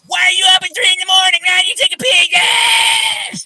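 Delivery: high, loud, fast shrieking nosy neighbor; hammed-up screech from the window; mock screaming neighbor. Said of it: "screaming out".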